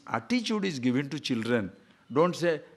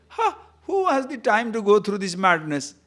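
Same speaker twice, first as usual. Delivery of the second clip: ordinary talk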